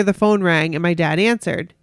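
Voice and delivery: ordinary talk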